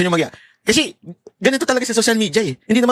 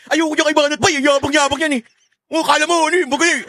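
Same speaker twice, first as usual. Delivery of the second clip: mock critic voice; 7 semitones higher than usual. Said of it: "yung mga naririnig ko".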